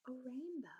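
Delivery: a soft, breathless secret answer; hushed whisper. A narrator's reading. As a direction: whisper the quiet girl's answer. Quiet heroine answers softly.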